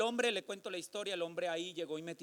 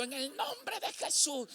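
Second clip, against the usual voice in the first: commanding prayer; 10 semitones higher, slower than usual. Retold or imitated. Casting out demons in prayer.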